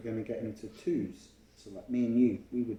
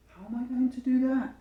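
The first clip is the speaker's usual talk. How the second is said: put-on child's worried question; 13 semitones higher, 5 dB louder than usual. Child narrator wonders, high voice.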